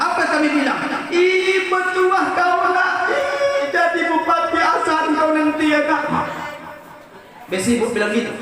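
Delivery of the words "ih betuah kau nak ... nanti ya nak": high, slow, drawn-out mock scolding shout; hammed-up angry mother cursing with a blessing; mother yelling at her child in mock fury. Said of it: "kalau udah marah sama anak".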